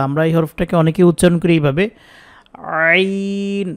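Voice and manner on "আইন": আইন with the opening squeezed hard and drawn out; over-pressed. Shown as a demonstration. Teacher showing students the common mistake, over-pressed আইন. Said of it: "এই অনেক ডাবাই".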